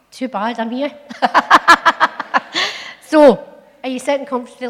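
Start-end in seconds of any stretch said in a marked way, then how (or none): 1.0-3.1 bursts into a loud, high laugh; a real laugh at his own joke. Real, not acted. 3.1-4.7 loud, fast call to the room; storyteller opener. Real, not acted.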